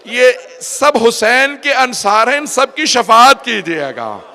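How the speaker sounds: loud, high imitation of a weeping plea; retold tearful plea. Zainab pleading to her mother for the companions, loudly lamenting. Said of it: "जनाब ज़ैनब ने रो के कहा".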